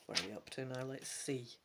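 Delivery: usual speaking voice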